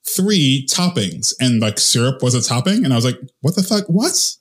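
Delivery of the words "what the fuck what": high incredulous what the fuck; shocked outburst at the waiter's menu; disbelief. Retold or imitated. Retelling the syrup topping, high incredulous outburst.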